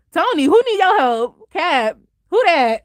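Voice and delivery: high, exaggerated scoffing questions; hammed-up diva put-down; sassy mock dismissal. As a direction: dismiss each hero with high-pitched mock sass. Acts out heroine dismissing the heroes.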